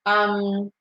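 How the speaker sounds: usual speaking voice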